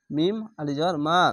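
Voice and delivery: usual speaking voice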